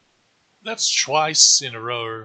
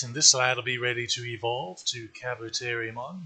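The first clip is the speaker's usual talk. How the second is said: ordinary delivery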